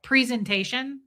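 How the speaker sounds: presentation as PREE-zen-tation; long ee in the first syllable of presentation; own presentation pronunciation. Shown as a demonstration. Host showing how she says it, PREE in presentation. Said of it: "Like, I say".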